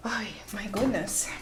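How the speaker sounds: whispered, moved; whispered sigh. Real, not acted. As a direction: breathe it out, overwhelmed. Moved to tears by reading.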